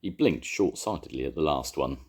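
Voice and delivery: ordinary delivery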